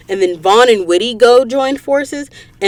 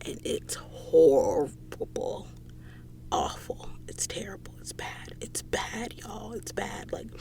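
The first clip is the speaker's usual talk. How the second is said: soft, breathless whisper of dismay; 17 dB quieter than usual. Real, not acted. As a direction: whisper it like it hurts. Grieving over death, whispered.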